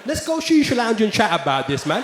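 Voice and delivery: lightly voiced street lad; roadman invite. Lad inviting the comedian to shisha, roadman. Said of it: "People were coming up to me".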